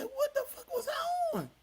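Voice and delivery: high, laughing shriek of disbelief. Retold or imitated. Reacting to his old footage.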